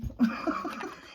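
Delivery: a quick high laugh at herself; breathy laugh. Real, not acted. Amused at herself over the wrong day, laughing. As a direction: laugh off the mistake. Laughing at her own mix-up.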